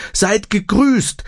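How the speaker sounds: put-on high, slow old woman gasping out a hello; panting greeting. A narrator's reading. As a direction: wheeze the greeting out, winded from hurrying. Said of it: "keuchte die Frau". (hoch und langsam, keuchend wie eine alte Frau außer Atem)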